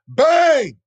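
shouted bang, high and loud; imitated gunshot, shouted high and loud. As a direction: yell it like a gun firing. Gun goes off.

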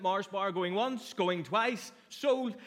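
playful auction call, going once, going twice; auctioneer. Pretend. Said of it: "the classroom would become like an auction room".

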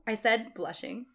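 ordinary delivery

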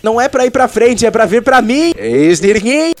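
high, loud call across the studio. Acted. Host calls guest to him.

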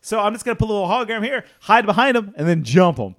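fast, loud smug villain plan; scheming villain. Acted. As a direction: rattle off the plan like a cocky villain. Voicing Visser's plan smugly.

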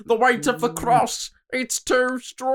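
high, slow strained mock voice; hammed-up Jesus. Joking as Jesus under the cross, hammed-up strain. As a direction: groan it out as a burdened Jesus.

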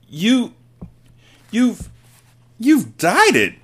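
very high, halting shocked shriek; aghast mother, pitched right up. A narrator's reading. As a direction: stammer out a high shriek, with gaps. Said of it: "shrieked the mother".

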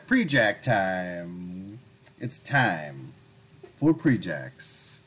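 slow drawn-out segment intro; announcer voice. Acted. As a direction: announce it like a show host.

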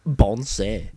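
laughing gibberish word. Real, not acted. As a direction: giggle it out. Laughing callback.

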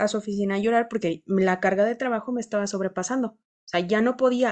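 ordinary talk

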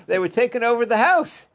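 laughing as the pitch climbs; amused. Real, not acted. Amused by the chicken invasion.

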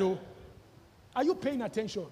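ordinary delivery

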